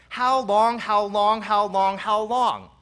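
loud pleading repeated cry; loud anguished how long. Acted. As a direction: throw the lament out with force. Preacher voices the psalmist again.

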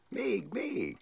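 high happy call of "Még, még!"; gleeful child cry. Retold or imitated. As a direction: cry "Még, még!" like a delighted little girl being tossed. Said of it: "kiáltotta".

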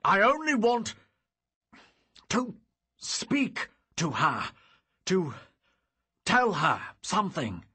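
high, loud pleading cry; plead, almost shouting; desperate shout. Acted.

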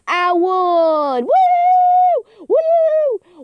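high, loud winner's shriek; real ecstatic celebration; victory whooping. Real, not acted. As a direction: scream that you won, then whoop piercingly. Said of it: "that's my victory dance".

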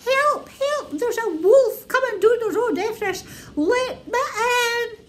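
high panicked piglet voice; high frantic pig crying for help; frantic little pig. A narrator's reading. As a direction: squeal for help, high and scared. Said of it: "and said".

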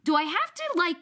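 hammed-up, let the husband have it; wife berating her husband in a hypothetical; exasperated scolding. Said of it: "I would have turned to my husband and said".

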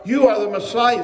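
loud, high proclamation; crying out. Acted. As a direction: cry it out like an awed crowd. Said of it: "people want to cry out".

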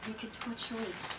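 soft aside about the noise; hushed aside. Real, not acted. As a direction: say it under your breath off to the side. Hushed aside to someone nearby.

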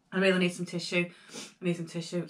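snuffly, nose running; runny nose. Real, not acted. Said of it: "my nose is running".

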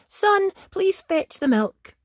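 high, loud motherly call; Mum's request. A narrator's reading. Mum asking her son to fetch milk, high sing-out call. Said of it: "When Mum said".

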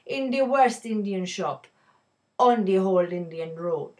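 reading the poem in a put-on Indian accent; lightly voiced Indian accent, storytelling the shopkeeper's day. Teacher reading the poem to her viewers in an Indian accent. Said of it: "I'm going to have to don an Indian accent".

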